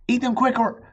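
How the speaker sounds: high urgent call; hurried urging. A narrator's reading. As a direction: rush them to eat. Amelia urging while riding.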